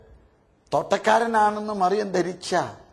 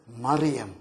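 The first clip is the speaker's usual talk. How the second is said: tender whispered name; 9 semitones lower, 5 dB quieter, much slower than usual. Acted. Jesus calls Mary tenderly.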